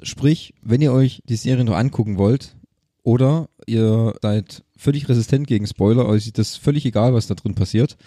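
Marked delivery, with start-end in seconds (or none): none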